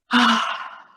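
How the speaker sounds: long acted exhale of relief; relieved sigh. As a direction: let out a big breath, finally free. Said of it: "finally feels like".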